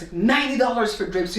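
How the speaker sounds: normal talking voice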